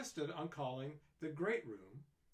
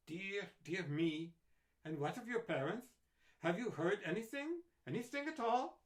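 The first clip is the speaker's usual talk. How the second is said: rising higher, urgent questions; worried doctor; 6 semitones higher, 5 dB louder than usual. A narrator's reading. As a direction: press the girl anxiously. Doctor voiced in story.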